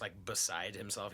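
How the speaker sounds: usual speaking voice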